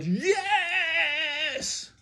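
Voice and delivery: very high, screeched long yes; yelling. A narrator's reading. Cashier arguing back at the father, yelling. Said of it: "the man yelled".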